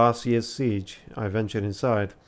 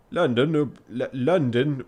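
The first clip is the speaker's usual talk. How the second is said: attempted Yorkshire accent; 2 semitones higher, 3 dB louder than usual. Shown as a demonstration. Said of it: "I'm trying".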